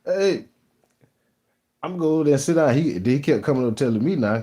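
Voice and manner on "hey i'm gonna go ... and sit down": pitched-up mock coworker; lazy coworker. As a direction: mimic him quitting to sit down, higher. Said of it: "He talking about".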